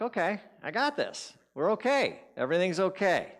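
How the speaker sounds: high, emphatic pep talk to oneself; anxious self-reassurance. Retold or imitated. Blindfolded walker talking himself into confidence, over-reassuring. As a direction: insist that all is fine, pitched high. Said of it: "and I'm thinking, like".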